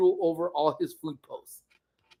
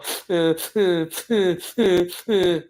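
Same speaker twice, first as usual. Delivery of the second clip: mock sniffling. Imitating Amy in the kitchen, mock sniffling. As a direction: fake whimpering sobs. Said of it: "I just hear her sniffling".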